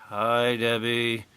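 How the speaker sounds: slow, deadpan sarcastic greeting. Retold or imitated. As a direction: say it flat, mocking the crowd. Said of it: "You can hear the sarcasm".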